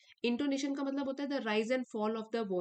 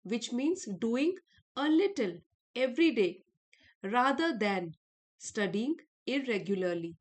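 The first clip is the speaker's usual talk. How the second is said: each English word read out slowly and clearly; slow lesson reading; 4 dB louder, much slower than usual. Acted. Teacher reading the English lesson text aloud to students, slow and clear. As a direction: dictate it slowly to students, stressing little and every day.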